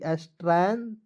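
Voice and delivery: ordinary talk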